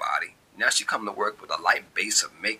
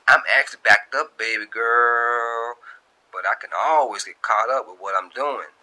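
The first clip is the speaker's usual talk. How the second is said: [name] voice; 4 semitones higher, 7 dB louder than usual. Acted. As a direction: sweet-talk her while busy. [name] answers on intercom.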